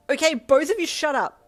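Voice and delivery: loud, high, sharp snap; snapping. Acted. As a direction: snap at them to stop. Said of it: "you snapped".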